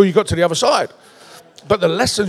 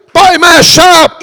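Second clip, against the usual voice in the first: loud, high snap of shut up; crowd telling him to shut up; 8 semitones higher, 8 dB louder than usual. Acted. Crowd shouting down the blind beggar, loud.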